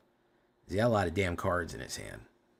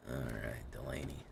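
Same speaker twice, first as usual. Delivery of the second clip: hushed whisper to himself; 8 semitones lower, 11 dB quieter than usual. Real, not acted. Streamer whispering a name to himself. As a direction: drop to a breathy whisper.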